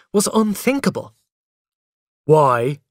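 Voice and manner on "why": loud, cross little question. A narrator's reading. Boy challenges mother's order, crossly. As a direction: demand it like a cross child. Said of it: "I asked crossly".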